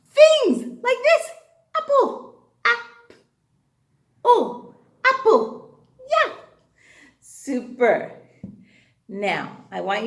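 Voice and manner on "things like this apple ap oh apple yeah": high, loud, laughing reveal of apple; delighted reveal. Shown as a demonstration. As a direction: show it like magic.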